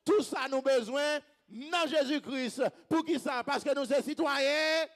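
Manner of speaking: loud, emphatic congregational preaching; rousing preacher's call; full-force preaching. Real, not acted. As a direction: drive each phrase out to the crowd.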